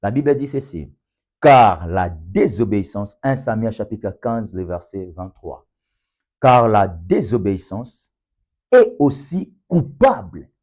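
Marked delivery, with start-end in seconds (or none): 1.4-3.2 slow, loud and deliberate Bible reading; slow scripture reading. Real, not acted. Preacher reading Samuel so all understand, slow and emphatic. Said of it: "je vais le lire ainsi lentement". 6.4-10.5 loud, deliberate reading, rising high on "coupable"; slow scripture reading. Real, not acted. Preacher reading the verse so all understand, slow and loud. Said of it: "je vais le lire ainsi lentement".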